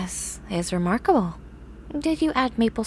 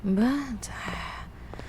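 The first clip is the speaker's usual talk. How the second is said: soft, unvoiced whispered planning; 9 dB quieter than usual. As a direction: whisper the list to yourself. Murmurs shopping plans to herself.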